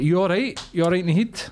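high, incredulous retort to a rude driver; mock challenge.

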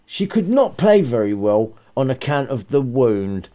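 usual speaking voice

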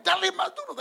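high, loud retold shout; urging shout. Father urges his daughter with the wrench, shouting. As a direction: push her to hit harder.

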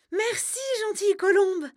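put-on high, loud, delighted girl's voice; girl's exclamation. Margot thanks the dove, high and delighted. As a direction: cry out your thanks like an enchanted young girl. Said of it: "s'exclame la jeune fille".

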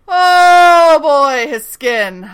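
loud slow disgusted groan; mock disgust. Real, not acted. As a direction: savor how gross it is. Gross-out reaction to scene.

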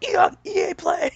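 high shrieking fanboy cheer; mock hype shout. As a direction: scream it like a hyped fan.

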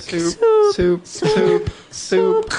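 piercing high alarm beeps. Pretend. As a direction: squeal it like an alarm clock. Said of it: "It turns into an alarm".

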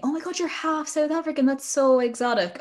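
high-pitched mock gushing; gushing admirers. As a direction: imitate people fascinated by someone exotic. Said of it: "people are always saying".

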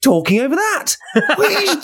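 very high-pitched, incredulous squeal; comic outrage. Acted. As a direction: splutter in mock fury through laughter. Acting out outrage at talking fiancée.

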